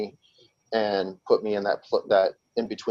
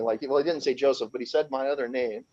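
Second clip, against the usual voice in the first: ordinary talk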